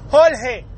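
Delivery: "Jorge" slowly, pronounced Hol-hey. Shown as a demonstration. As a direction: swap the R in "Jorge" for an L. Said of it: "We change them shits for fucking L's".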